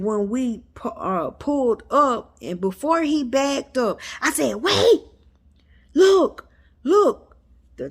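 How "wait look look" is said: high, loud bursts with gaps between; urgent calling out. Retold or imitated. Mom alerting the driver before he backs up, urgent call. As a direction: get their attention fast, louder and higher. Excitedly calling out to point at truck.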